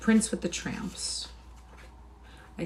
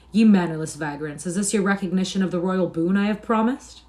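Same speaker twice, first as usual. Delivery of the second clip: sharp, indignant rebuke; mildly raised, stung reproach; 2 semitones higher, 7 dB louder than usual. A narrator's reading. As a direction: scold with a touch of royal offence. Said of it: "He said sharply".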